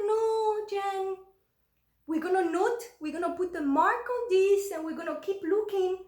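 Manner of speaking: lightly voiced pushy mother-in-law; imitating the mother-in-law.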